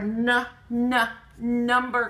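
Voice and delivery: "na, na, number" slowly in separate beats; first sound repeated. Shown as a demonstration. Teacher stressing the starting n, "na, na, number". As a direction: tap the n twice before "number". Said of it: "The na is at the beginning".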